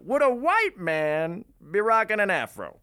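high, loud theatrical put-on voice; hammed-up high-pitched question; Reggie McDaniels impression. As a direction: declaim it high and big, close to laughing. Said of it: "he's like, says".

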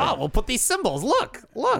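high, excited mock voice; mocking designer. Host mocking the bar's font designers, high gushing imitation. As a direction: gush in a high-pitched mock voice.

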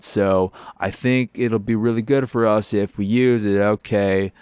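a goofy, put-on dude voice, slow at first; weird bro voice. Mocking the weird game character in a goofy voice. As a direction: ham up a strange stoner character. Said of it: "I feel like he's".

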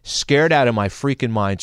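ordinary talk

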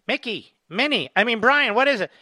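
lightly mocked Biden. Host mocks Biden answering.

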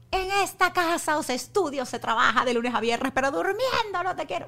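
loud, high, furious mother's tirade; a mother yelling at her lazy teenage son; scolding mother. Retold or imitated. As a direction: lay down the house rules at full voice. Mother scolding son who sleeps late.

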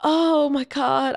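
drawn out like you want to disappear; mock despair. Mortified while two hundred passengers stare, slow dramatic despair. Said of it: "I'm like".

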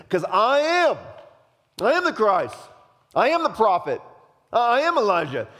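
loud, high, self-glorifying proclamation; grandiose boasting. Acted. John imagined claiming to be the Christ, grandiose and loud. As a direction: proclaim it like you demand to be worshipped.

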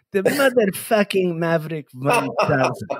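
put-on slow Persian-accented motherfucking with z sound; dad's Persian accent. Host swearing in his father's Persian accent. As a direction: drawl motherfucking the way dad would. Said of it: "my dad liked to say fuck".

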